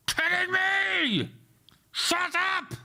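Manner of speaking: high, angry yell at the screen; furious shout. Real, not acted. Coder yelling at his error-filled program, furious shout. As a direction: explode at the computer.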